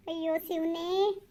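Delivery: slow, drawn-out mock despair; mock dismay. Lover mocking the wife's eighth-grade education with mock despair. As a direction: groan it theatrically.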